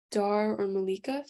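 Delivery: ordinary talk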